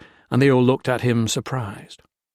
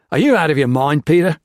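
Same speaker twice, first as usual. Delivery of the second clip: loud incredulous outburst; exploding; 5 semitones higher, 7 dB louder than usual. A narrator's reading. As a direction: blow up at him. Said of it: "Larkin exploded".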